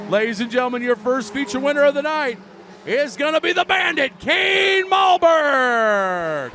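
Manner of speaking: announcer voice. Real, not acted. Announcing the feature winner.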